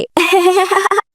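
high-pitched pleased giggle; showy giggle. Acted. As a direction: giggle like a girl showing off. Girl showing off her jacket. (গর্বের খিলখিল হাসি)

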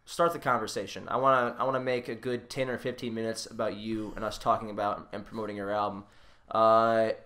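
ordinary delivery

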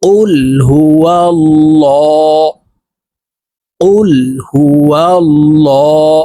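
হুয়াল্লাহ with a thick, full-mouthed ল্লা; slow recitation with the lam thick; heavy lam. Shown as a demonstration. Teacher models heavy lam in হুয়াল্লাহ for students. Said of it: "দেখুন এইভাবে পড়তে হবে".

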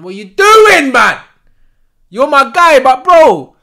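loud, frustrated telling-off; exasperated shout. Real, not acted. Fan scolding his guy for the miss, exasperated shout. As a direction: bellow it at your own player.

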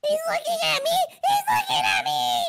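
high, rising alarm; panicked yell. Acted. Brother spotted by the monster, panicked high yell.

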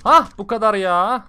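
slow, loud triumphant exhale; loud relieved cheer after the fight; relieved outburst. Real, not acted. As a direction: let the tension burst out loud.

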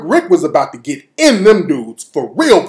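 ordinary talk, nothing marked

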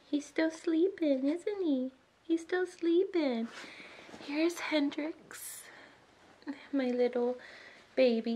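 high, drawn-out cooing over a newborn; tender, high-pitched mommy voice. Real, not acted. Cooing over her sleeping newborn.

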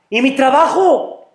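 high, urgent protest; crying out. Acted. Man about to be taken asks about his job, crying out. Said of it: "él empieza a gritar".

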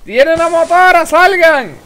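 shouting a warning to the squad; grenade warning yell. Real, not acted. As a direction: bellow it out in alarm. Enemy motorcycle incoming, warning teammates.

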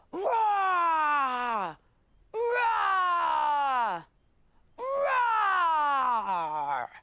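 loud, high, long roared cries; lion roar. Acted. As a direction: roar out like a hammed-up lion gang. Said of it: "said the lions".

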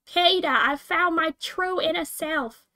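drawn-out pleading "Peter"; nasal-free but high mock Lois calling Peter; Lois Griffin voice. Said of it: "I don't know what that voice was".

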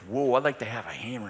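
hushed, low, dreamy wish; awed child. Retold or imitated. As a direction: whisper it in wonder. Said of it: "I'm like".

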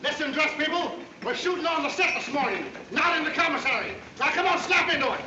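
loud, high, fast call to the set; barked orders. Acted. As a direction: hurry the crowd along, shouting. Director calling extras to the set.

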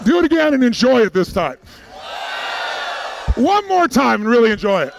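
loud shout over the crowd; rousing call. Real, not acted. Seminar leader urging a cheering audience to repeat, rousing shout. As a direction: urge the whole room on, loudly.

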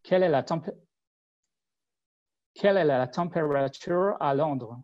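French question in an English accent. Shown as a demonstration. Asking the French voice search in an English accent. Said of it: "but with an English accent".

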